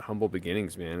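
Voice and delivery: ordinary talk, nothing marked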